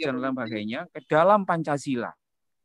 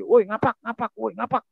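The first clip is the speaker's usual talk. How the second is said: put-on higher, calling-out jeer at the ngapak kids; mock bullying taunt; 5 semitones higher than usual. Santri mocking ngapak classmates, a mock jeering call. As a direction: taunt them like a schoolyard bully.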